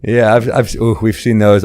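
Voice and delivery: ordinary talk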